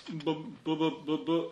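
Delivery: soft stammer stuck on "B, b"; broken, quiet "b" sounds from a tongue-tied speaker; stuttering attempt. A narrator's reading. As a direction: repeat "b" haltingly, failing to get the word out. Ferdinand stammers another failed question.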